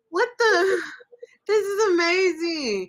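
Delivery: high, loud amazed cry of disbelief; reliving the first studio session in overwhelmed awe; amazed outburst. Retold or imitated. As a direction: burst out in wonder, half laughing. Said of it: "I'm like".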